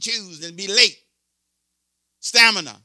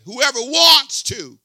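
Whoever wants to, higher, louder and slow; rising preacher shout; 6 semitones higher, 6 dB louder, slower than usual. Real, not acted. Preacher pressing the congregation, slow loud shout. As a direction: build to a near shout on wants.

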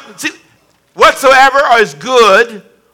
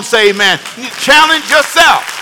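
normal talking voice

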